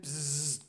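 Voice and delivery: drawn-out "bzzz" buzzing noise; imitated insect buzz. Storyteller imitating what was heard in the shower, imitated buzz.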